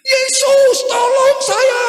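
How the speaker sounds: high, loud desperate shout; crying out loud. Retold or imitated. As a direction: cry out to Jesus for help. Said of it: "Saya mulai berteriak dengan kuat". (teriakan keras minta tolong)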